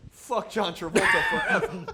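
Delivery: exasperated, laughing yell; laughing outburst. Real, not acted. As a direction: shout it out, then laugh. Mock outrage at the actor.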